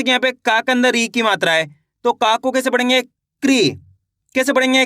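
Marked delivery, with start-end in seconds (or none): 3.0-3.7 क्री said as one syllable, k running into ri, pitch falling; क्री with k and r joined, firmly let fall; forceful KRI sound. Shown as a demonstration. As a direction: punch out क्री as a single "kree". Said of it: "तेजी के साथ आपको इसका उच्चारण निकालना है".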